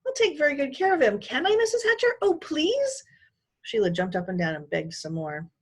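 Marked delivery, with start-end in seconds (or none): 0.0-3.0 put-on high, pleading girl voice; eager girl pleading, much higher; begging. A narrator's reading. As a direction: beg to be allowed, sweetly. Sheila begging.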